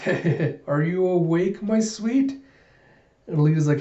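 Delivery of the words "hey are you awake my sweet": higher, rising mock endearment; teasing sweet-talk. Figure greeting Alita as she wakes, teasing sweet-talk. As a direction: coo it playfully, pitch climbing at the end.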